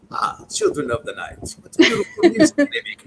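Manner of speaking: a hushed start, then swooping high in a hammed-up count voice; mock vampire, savouring the wolves' howl; imitating Dracula. Retelling Dracula's line about the wolves, hammed-up vampire voice. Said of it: "He goes".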